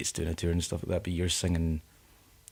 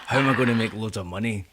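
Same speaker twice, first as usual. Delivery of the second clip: higher, louder, laughing money-grubber; hammed-up, high-pitched money worrier; mock sellout; 5 semitones higher, 6 dB louder than usual. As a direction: play a greedy sellout, cracking up. Mocks a money-hungry sellout musician.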